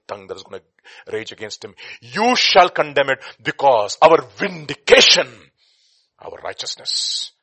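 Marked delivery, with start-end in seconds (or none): 2.0-5.3 loud, slow, emphatic preaching; forceful proclamation. Real, not acted. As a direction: declare it at full force. Preacher declares verse at full force.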